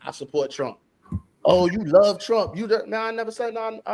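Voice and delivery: ordinary delivery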